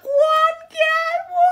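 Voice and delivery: shocked shout. Retold or imitated. Reacting to Tyler's mum's words, a high stunned shout. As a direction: shriek it out in disbelief. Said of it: "I was like".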